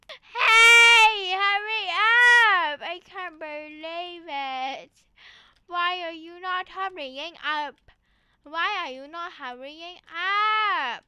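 loud, drawn-out whiny yelling; hammed-up crying shout; wailing mock shout. Shown as a demonstration. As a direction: holler impatiently as if crying. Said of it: "shouting, and crying".

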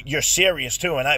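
ordinary delivery

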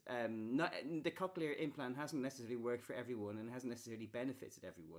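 usual speaking voice

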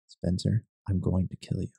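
low, soft, chilling threat; quiet menacing whisper. Retold or imitated. Imitating a stranger who whispers a death threat, quiet and menacing. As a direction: drop it to a cold menacing hush. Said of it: "and just whisper".

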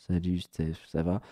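low, soft, deflated hello; wife greeting Steph while grieving the cat, low and deflated; dejected greeting. Retold or imitated.

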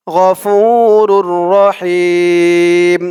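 রহিম with হিম drawn out slowly; গফুরুর রহিম slow, হিম held; long him. Shown as a demonstration. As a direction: stretch হিম at the end of রহিম. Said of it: "হিম বলে টান দিতে হবে".

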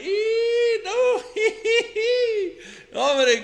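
high, loud guilty laughter; nervous laugh. Acted. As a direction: laugh it off, caught in the lie. Laughs off the accusation. (risa nerviosa del compadre pillado)

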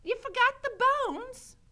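high, loud character voice; Mig. A narrator's reading. Mig watching Gregory eat, high loud voice. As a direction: pipe up loudly in astonishment. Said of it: "said Mig".

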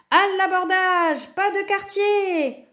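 high, long-drawn battle cry; wild pirate yell. A narrator's reading. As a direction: howl the attack, stretching the words. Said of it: "hurlèrent-ils déchaînés".

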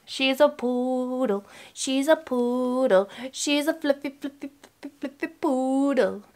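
soft cooing. Real, not acted. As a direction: coo softly, drawing out the words. Cooing over her pet poodle.

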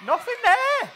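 high, loud startled yelp; startled scream. Acted. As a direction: jump out of your skin mid-trick. Startled by rabbit in hat.

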